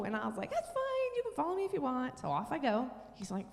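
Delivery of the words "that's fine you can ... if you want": high, singsong retold brush-off; airy mock nonchalance; breezy dismissal. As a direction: wave off the objection in a high voice. Retells brushing off husband's refusal.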